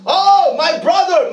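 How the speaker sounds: high, drawn-out heartfelt call; crying out. Acted. Christ calling to the believer as brother, crying out. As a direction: stretch it slowly as an impassioned appeal. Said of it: "he's crying out in you".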